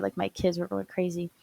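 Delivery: normal talking voice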